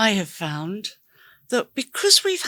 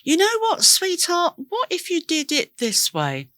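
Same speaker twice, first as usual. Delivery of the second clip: much higher, tender coaxing to oneself; gently reassuring yourself, pitched up and sweet; kind to myself; 8 semitones higher than usual. Retold or imitated. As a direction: coax yourself like talking to a dear friend. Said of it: "said to myself something along the lines of".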